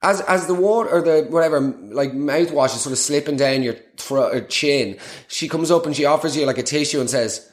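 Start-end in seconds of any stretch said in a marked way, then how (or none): none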